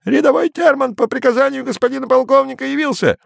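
high squeaky cockerel shout; squeaky rooster voice. A narrator's reading. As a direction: yell it in a cracking rooster voice. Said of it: "закричал петушиным голосом".

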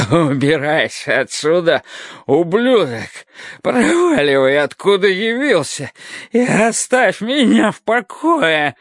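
rising from growl to high scream; drunk yelling. A narrator's reading. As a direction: build from snarl to shrieking yell. Drunk drives the narrator away.

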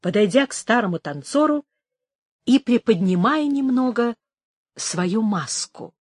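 usual speaking voice

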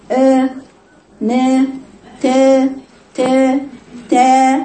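long, held open "aa" syllables; drawn-out open fatha syllables; open vowel demo. Shown as a demonstration. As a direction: sound out آ، نا، تا one at a time, held long. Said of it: "في فرق بين الصوتين".

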